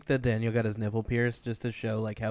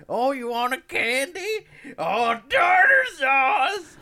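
high, loud cartoon old-timer yell; scary old man; 9 dB louder, slower than usual. Old man looming close to a child, imitated in a crazed drawn-out shout. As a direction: holler it like Yosemite Sam, stretching the words. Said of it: "He sounds like a Yosemite Sam".

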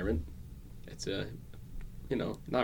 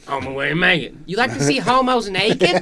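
higher, louder quoted line through a laugh; imitating a goofy movie character; mock Joe Dirt line; 8 semitones higher, 9 dB louder than usual. Quoting Joe Dirt scene.

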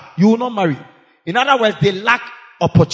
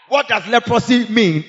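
ordinary talk

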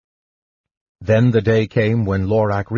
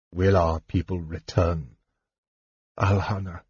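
put-on low, soft, broken old elf king; a grieving father's hushed question; weary old king; 3 semitones lower, 6 dB quieter than usual. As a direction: ask it quietly, almost without hope. Old king asks his daughter.

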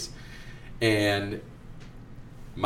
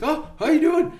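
put-on much higher boyish greeting; high kid voice; 6 dB louder than usual. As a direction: squeak it out like an excited boy. Student voiced in story.

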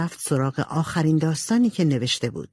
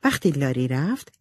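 ordinary delivery, nothing marked

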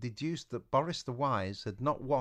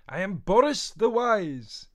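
put-on slow grand Welsh accent, higher and louder; slight Welsh accent; 11 semitones higher, 10 dB louder, slower than usual. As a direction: announce yourself like a Welsh sage. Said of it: "developed a slight Welsh accent".

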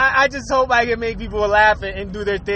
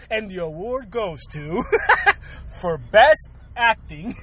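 low, slowing ceremonial announcement; mock award presenter; 4 semitones lower, slower than usual. Podcaster presenting a joke award for acting, mock presenter. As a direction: announce it with hushed, drawn-out suspense.

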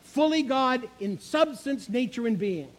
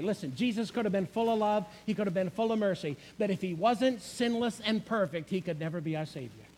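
ordinary talk, nothing marked

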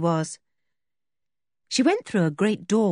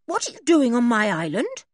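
indignant, higher-pitched challenge; demanding; 6 semitones higher than usual. A narrator's reading. George to policemen on her island, demanding. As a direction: challenge the intruders. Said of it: "demanded George".

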